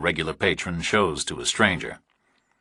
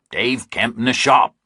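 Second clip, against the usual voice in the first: higher, louder impatient farmer; 5 semitones higher, 4 dB louder than usual. A narrator's reading. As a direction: snap it at someone you think slow. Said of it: "the third farmer said impatiently".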